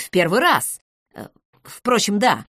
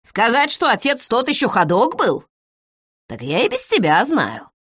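grumpy old woman, a bit higher, mocking; an unwelcoming old widow sizing up a stranger; gruff old village woman; 7 semitones higher than usual. Старуха встречает незваного брата.

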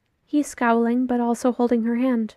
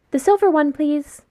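higher and a bit louder, polite request; higher, raised-voice request; 5 semitones higher, 5 dB louder than usual. A narrator's reading. Child answers from behind, calling.

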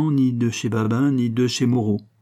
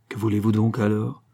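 ordinary delivery, nothing marked

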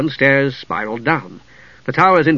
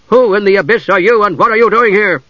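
loud, raised-pitch challenge from the red-robed mage; demanding; 7 semitones higher, 5 dB louder than usual. A narrator's reading. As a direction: demand answers forcefully. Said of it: "the red robe demanded".

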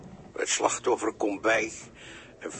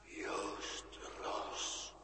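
toneless ghostly whisper, as if from far away; eerie whispered call; 17 dB quieter than usual. Acted. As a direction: breathe the name like a phantom. Spookachtige stem roept Joost. (de geest fluistert spookachtig Joosts naam)

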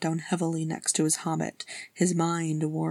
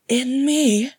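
higher, louder forced laughing reply; 9 semitones higher, 8 dB louder than usual. Acted. Bilbo deflecting Arwen, forced laughing reply. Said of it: "Bilbo laughed, though it was a little forced".

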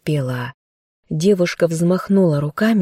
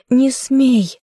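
higher, desperate shouted command; 8 semitones higher than usual. Acted. Girl shouts at her dead bear not to dare. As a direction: forbid him to die. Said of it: "закричала она на него".

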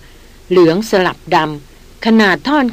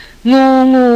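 retold shout; 5 semitones higher, 4 dB louder, much slower than usual. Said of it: "เสียงน้อยตะโกนเอ็ดบอกว่า".

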